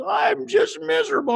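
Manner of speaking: high-pitched wailing imitation of a miserable student; mock crying student. Crying student answers her band director at the pep rally, mock high wail. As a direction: wail it like a girl bursting into tears. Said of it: "And she starts crying".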